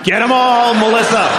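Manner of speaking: hammed-up high-pitched rooting cry; mock cheering on.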